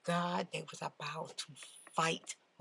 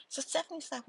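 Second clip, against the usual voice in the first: usual speaking voice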